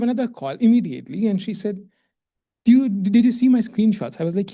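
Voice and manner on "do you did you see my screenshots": higher, louder, imitating an eager mom; eager mother. Mother calling right back about her screenshots, imitated eagerness. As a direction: burst in with the question, as an excited mother would.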